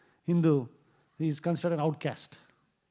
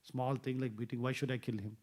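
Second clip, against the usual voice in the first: softer, calming reassurance; pacifying; 4 semitones lower, 9 dB quieter than usual. Retold or imitated. King soothing his angry wife, soft and calm. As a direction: gently talk her down. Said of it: "he tried to pacify her".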